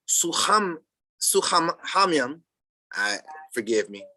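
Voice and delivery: ordinary talk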